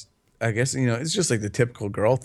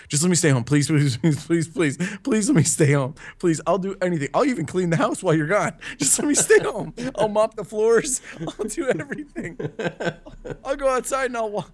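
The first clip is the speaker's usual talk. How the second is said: high, laughing mock begging to the wife; 7 semitones higher than usual. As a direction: whine like a kid pleading to stay home. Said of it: "I'm in, like, begging mode".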